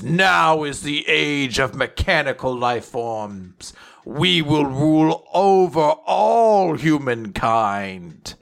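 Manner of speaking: slow, grand robot-villain voice; villain proclamation. Retold or imitated. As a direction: proclaim it slowly like a mechanical overlord. Villain Ziggy proclaims rule.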